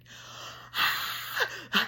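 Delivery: long, high voiceless shriek; imitated scream. Woman startled by dog jumping up, mock breathy scream. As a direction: act out a scream on breath alone. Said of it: "I started screaming".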